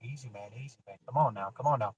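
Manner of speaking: soft, calming coax; low gentle soothing to a distressed son; talking easy. Retold or imitated. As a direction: ease him down like a spooked animal. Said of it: "but I'm talking easy".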